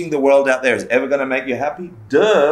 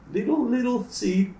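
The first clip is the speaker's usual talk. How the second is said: tiny high voice; 10 semitones higher, 6 dB quieter than usual. Shown as a demonstration.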